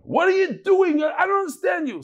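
high, loud screamed rebuke; mock screaming. Rebbe outside, screaming at the Karliner guy, mock scream. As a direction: imitate a Rebbe screaming in exasperation. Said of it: "the Rebbe starts screaming at him".

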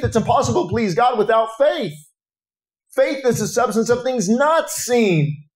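high-pitched, urgent revival sermon; impassioned preaching. Real, not acted. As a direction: preach with fervor, driving each phrase onward. Preaches at full force.

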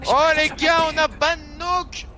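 real triumphant cry; excited announcement. Real, not acted. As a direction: shout the news to everyone. Player celebrates banning someone.

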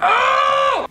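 sudden high yelp; high startled "oh". Real, not acted. Friend goofing around before filming, high yelp. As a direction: let out a short high "oh!".

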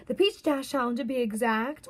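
ordinary talk, nothing marked

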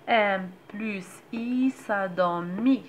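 each sound drawn out, clear and slow like a reading teacher; slow syllable blending. Shown as a demonstration. Teacher showing mothers how to blend m and a vowel, slow and clear. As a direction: sound the syllable out slowly so a child can follow. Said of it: "غنطقها بحال غنطق اي".

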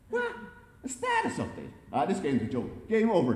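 fed up, building to a shouted dismissal; barked outburst. A narrator's reading. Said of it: "Jerry barked".

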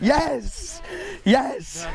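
high, jubilant cry; excited yes. Real, not acted. Celebrating the catch.

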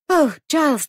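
high, loud burst of relief; relieved cry. Acted. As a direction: greet him with relief. Relieved to see husband.

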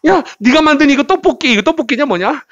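high, excited character voice; voiced cartoon friend. Teacher guessing what the pictured friend says about tteokbokki, high-pitched role-play. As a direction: pitch it way up, lively and chatty. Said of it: "이 친구가 뭐라는 것 같아".

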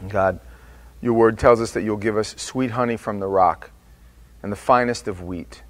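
ordinary talk, nothing marked

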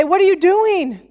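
high-pitched accusing question; mock scolding. Imagined scolding voice of God.

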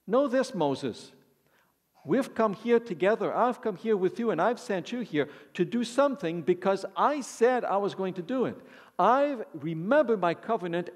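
preacher paraphrasing God to Moses. Acted. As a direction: speak as God reassuring Moses.

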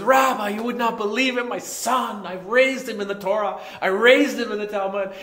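a fretting dad pouring out his woes; lightly voiced devout father; complaining father. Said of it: "And he says".

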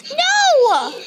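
loud, high-pitched cry of dismay; frustrated yell. Real, not acted. Game mishap, cries out in frustration.

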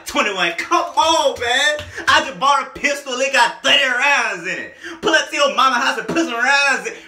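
loud, high-pitched lyric recital; hyped outburst. Retold or imitated. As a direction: shout the bars in excited disbelief. Hyped shouting of outrageous lyrics.

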